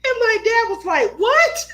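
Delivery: laughing so hard the voice cracks high; can barely get it out for laughing; breaking up into high laughter. Real, not acted. Laughing while retelling the story.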